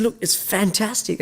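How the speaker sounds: high, upbeat retold compliment; enthusiastic praise. Diner engaging the waitress about his meal, enthusiastic.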